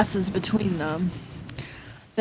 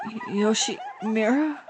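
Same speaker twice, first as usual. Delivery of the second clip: hesitant alarm; 8 dB quieter than usual. Acted. Girl unsettled by his laughter.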